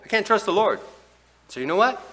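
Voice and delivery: high, loud cry of giving up; loud mock despair. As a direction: shout it like a man who has given up on the Lord. Voicing despairing backslider.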